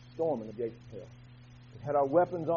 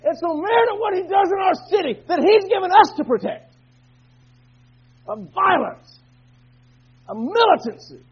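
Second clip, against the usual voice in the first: rising, louder preacher's cry against the devil; full-force preaching; 8 semitones higher, 6 dB louder than usual. Acted. Preacher stirring his church to holy anger, shouting. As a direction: preach it hard, building fury.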